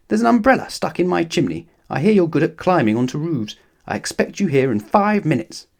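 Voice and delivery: a bit of a demanding snob; Mister Uppity's voice. A narrator's reading.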